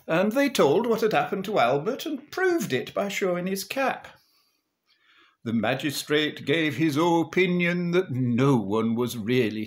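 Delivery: lilting Northern English storytelling; rhythmic music-hall dialect narration; comic monologue recital. Acted. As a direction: recite it as a droll Lancashire monologue.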